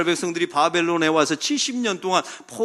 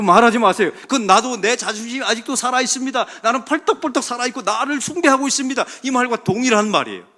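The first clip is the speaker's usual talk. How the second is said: high, loud, urgent exhortation; full-force preaching; 7 semitones higher, 6 dB louder than usual. Real, not acted. Preacher warning against saying "I'm hurt", full-force preaching. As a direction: press the warning on the congregation.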